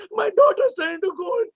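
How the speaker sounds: high, panicked wailing; frantic, begging for an answer; king frantic over the gold; freaking out. A narrator's reading. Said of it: "He was freaking out".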